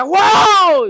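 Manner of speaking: high, loud wow outburst; mock zealous shout; imitated neighbour's yell. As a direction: whoop to jolt the sleeper awake. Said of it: "Y el de al lado grita".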